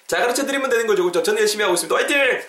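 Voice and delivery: high-pitched self pep talk, building to a cheer; 한껏 높인 목소리로 파이팅. Real, not acted. 강사가 스스로 기운을 북돋움. (높고 들뜬 응원조)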